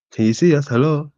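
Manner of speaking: normal talking voice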